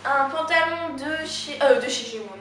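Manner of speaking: slowed, halting try with a stumble on the brand; hesitant, searching for the word; unsure first attempt, trailing on "de chez"; fumbled first take. Real, not acted. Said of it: "Excusez-moi, je recommence".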